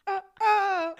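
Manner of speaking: high, loud gushing through laughter; delighted laughing. Real, not acted. Gushing about the song, laughing. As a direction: squeal with delight.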